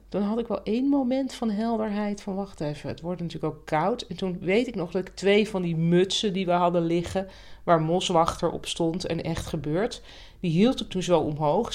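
usual speaking voice

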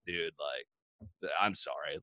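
low, soft apology; retold apology. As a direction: imitate a subdued sorry, voice dropped.

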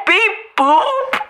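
high, bright robot sign-off; robot beeps. Acted. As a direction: chirp it like a little robot. The robot signs off its letter.

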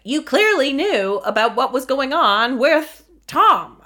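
high, drawn-out accusing sitcom-comic voice; Jerry Seinfeld. Acted. Host voicing a viewer's question for the reunion, Seinfeld impression. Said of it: "doing a Jerry Seinfeld voice".

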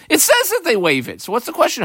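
high-pitched challenge to the question; incredulous objection. Acted. Student challenging why the Gemara even asks, incredulous.

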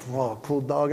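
low, soft, understated awe; cool admiration. Retold or imitated. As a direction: murmur it, playing it cool. Playing it cool at red light.

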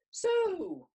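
put-on bright, friendly grown-up talking to a child; beaming with joy. A narrator's reading. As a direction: beam down at the little girl. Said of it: "he beamed".

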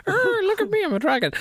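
squeaky mock dragon boast; knockoff dragon voice. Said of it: "like a knockoff Game of Thrones dragon".

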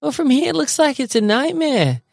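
high, fast put-on woman's voice; Cindy voice. Quoting Cindy in a high, quick voice. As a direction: squeak it out like the frightened Cindy. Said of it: "But Cindy".

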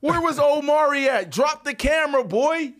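high, loud and teasing; calling out. Real, not acted. Calling out Omari for filming, loud and teasing. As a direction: shout him out playfully.

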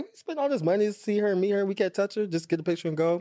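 high, fast, outraged fan voice; mock-outraged whining, rushed; indignant complaint. Fans griping to each other about not getting hugs, high outraged whine.

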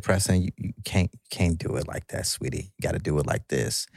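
soft, tender imitation of the wife; a hushed sweetie; gentle correction. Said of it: "she just said".

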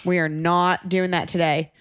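usual speaking voice

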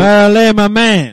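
hyped shout-out. Real, not acted. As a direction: holler it out for your buddy. Hyping up a shouted-out listener.

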